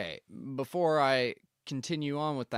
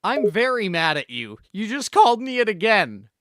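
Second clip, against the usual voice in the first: louder, higher indignant outburst; angry yell; 6 semitones higher, 9 dB louder than usual. Acted. Inspector to the homeowner who insulted him, angry yell.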